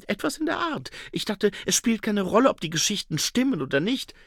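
high, eager salesman voice; a slick huckster talking himself up; schmieriger Händler. A narrator's reading. Schnapper speaks, narrator voices. (hohe, eifrige Händlerstimme)

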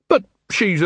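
high, complaining tradesman voice; a put-upon shopkeeper grumbling; Mister Flannel complaining to the watch; aggrieved shopkeeper. A narrator's reading. Flannel stammering.

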